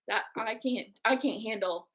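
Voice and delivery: normal talking voice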